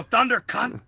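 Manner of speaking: high, loud mock outburst; mock road curse. Imitating a friend cursing in the car, mock outburst. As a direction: belt it like a driver cursing.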